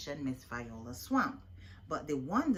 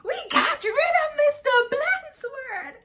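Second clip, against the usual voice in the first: high, loud triumphant shout; gleeful cheer; 11 dB louder than usual. A narrator's reading. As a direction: crow over getting rid of the sub. Class cheering their freedom.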